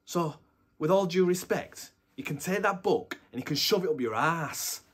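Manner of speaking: loud, furious outburst; angry shout. Acted. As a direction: explode at the boss. Detective tells off his boss.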